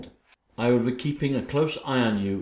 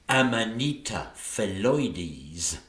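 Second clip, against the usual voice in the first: savouring; 3 semitones lower, much slower than usual. Acted. Relishing the poison's name.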